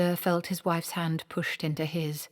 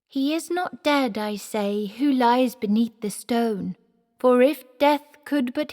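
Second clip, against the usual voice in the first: higher, louder, slow grand declamation; boy reciting in the actor manager's voice; 8 semitones higher, 7 dB louder than usual. Acted. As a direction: deliver the eulogy like a great tragedian. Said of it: "do you know my speech".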